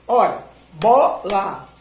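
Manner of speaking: "bola" stretched into two clapped syllables. Shown as a demonstration. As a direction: tap out "bola" in two slow beats. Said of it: "enquanto você tá falando as sílabas".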